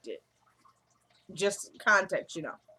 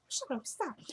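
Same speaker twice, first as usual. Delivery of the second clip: very soft, higher-pitched imitated hushing; hushed protest; 5 semitones higher, 12 dB quieter than usual. Husband trying to stop the fake moaning, hushed protest. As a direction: silence someone urgently under the breath, retold.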